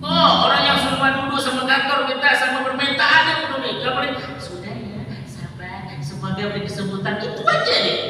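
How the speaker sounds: high, loud fiery preaching; preaching at full force. Acted. Preacher scolding the congregation, loud fiery preaching. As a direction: hammer the point home.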